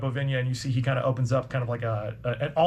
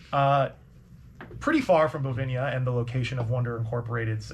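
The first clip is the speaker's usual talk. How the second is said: ordinary talk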